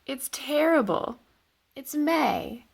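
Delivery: ordinary talk, nothing marked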